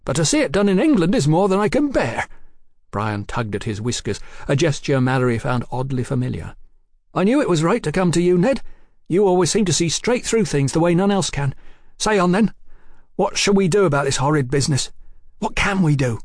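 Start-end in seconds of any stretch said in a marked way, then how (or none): 0.0-2.3 high, loud, quick outrage; indignant soldier. Acted. 7.1-16.2 higher, animated soldier's voice; earnest soldier brother. Acted.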